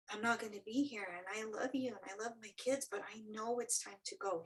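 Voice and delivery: ordinary talk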